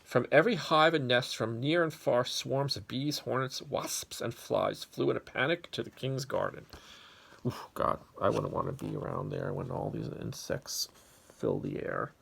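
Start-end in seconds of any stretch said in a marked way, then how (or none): 0.0-6.7 louder, animated storybook telling; lively story narration. A narrator's reading. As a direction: tell it big for the kids. Reads story to children.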